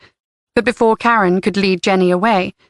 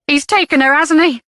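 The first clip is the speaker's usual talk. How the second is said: sharp, higher accusing snap; snapped; 7 semitones higher than usual. A narrator's reading. Mother accusing the head teacher, sharp and snapping. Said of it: "Denny snapped".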